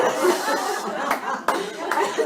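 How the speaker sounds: loud, high burst of laughter; big laugh. Real, not acted. Speaker after her joke about lockdown, big laugh. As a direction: crack up at your own joke.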